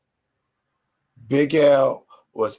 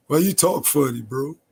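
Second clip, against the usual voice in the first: mocking kid; 5 semitones higher, 3 dB louder than usual. Mocking kids clowning his accent.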